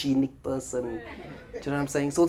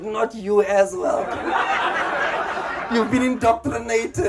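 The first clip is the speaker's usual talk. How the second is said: laughing disbelief; 7 semitones higher than usual. Retold or imitated. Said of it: "I was like".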